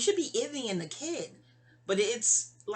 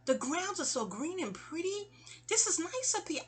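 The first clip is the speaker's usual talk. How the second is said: gushing, much higher, dreamy; airy high-pitched gushing; 9 semitones higher, 4 dB quieter than usual. Retold or imitated. To the ex at the prison table, gushing high and dreamy. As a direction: float it up high like a ditzy girl. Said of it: "I'm like".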